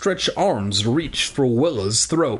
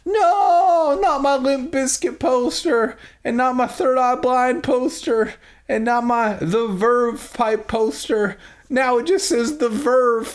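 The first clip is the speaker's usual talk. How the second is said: high, wailing mock lament over posters; hammed-up dismay; 10 semitones higher than usual. Willa mourning her ripped band posters, hammed-up high wail. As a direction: overplay a girl's despair for laughs.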